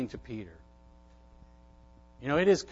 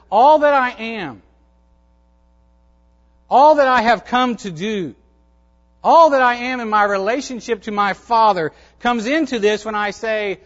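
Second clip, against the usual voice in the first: forceful preaching; 5 semitones higher, 7 dB louder than usual. Acted. Preaching Jesus' words at full force.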